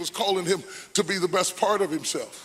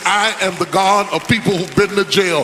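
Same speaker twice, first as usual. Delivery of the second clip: chanted, shouted litany, climbing higher and louder; full-force preaching; 6 semitones higher, 11 dB louder than usual. Acted. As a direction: preach it over the organ, building to a roar. Escalating preached litany over music.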